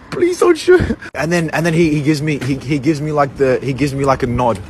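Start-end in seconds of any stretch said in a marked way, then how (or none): 0.1-1.0 high squeaky plea not to be shot; mock pleading. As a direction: beg for your life, half joking.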